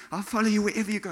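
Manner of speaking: eager disciple. Acted. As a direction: rush out a keen promise. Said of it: "the eager beaver disciple".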